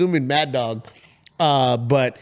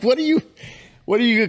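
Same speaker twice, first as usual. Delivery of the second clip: pitched-up what are you; high surprised question; 10 semitones higher, 3 dB louder, faster than usual. Retold or imitated. Asking the neighbor what he's up to, surprised and high. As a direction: sound caught off guard, high.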